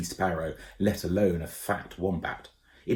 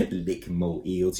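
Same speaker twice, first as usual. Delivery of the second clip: chuckling; slower than usual. A narrator's reading. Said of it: "chuckled Koo".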